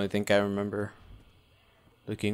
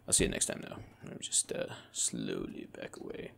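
whispering; 13 semitones lower, 10 dB quieter than usual. Real, not acted. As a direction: back away whispering so it won't hear. Sneaking past the monster.

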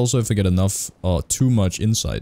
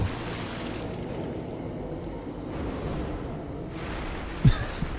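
a much softer, breathless wheeze of laughter; silent wheezy laugh; 11 dB quieter than usual. Real, not acted. Player laughing at the cutscene, silent wheeze. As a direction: laugh with almost no voice.

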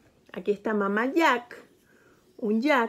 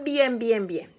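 plain reading voice

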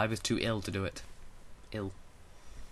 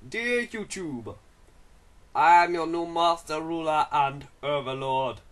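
slow, louder villain overlord proclamation; booming arrogant alien overlord; 5 semitones higher, 5 dB louder, slower than usual. Pretend. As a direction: declare your rule over the world. Villain declaration to YouTube.

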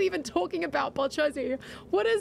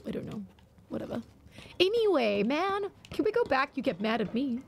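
normal talking voice

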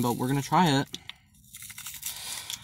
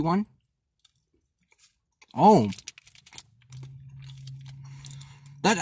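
talking while chewing food; speaking with chocolate in mouth; 3 dB quieter, slower than usual. Real, not acted. Seen 3: Bites bar at -2; lips pursed, jaw working through labelled words.

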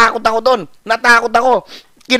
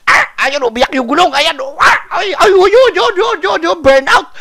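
high, loud thrilled yelling; yelling as the car burns out; excited reliving; 11 semitones higher, 4 dB louder than usual. Retold or imitated. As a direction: shout it out like the tyres are screaming. Reliving tyres screeching burnout.

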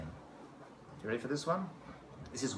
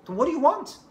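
surprised question; 12 semitones higher, 10 dB louder than usual. Retold or imitated. Father exasperated question.

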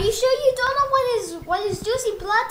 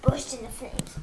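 whispering; 8 semitones lower, 8 dB quieter than usual. Acted. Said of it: "repeated incantation, whispered".

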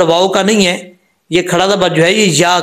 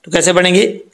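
ordinary delivery

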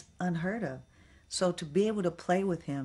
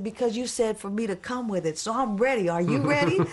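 high, rising to a loud challenge; retold fired-up retort, building in volume; defiant comeback; 6 semitones higher, 6 dB louder than usual. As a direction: throw the challenge back, louder and higher.

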